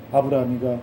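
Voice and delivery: usual speaking voice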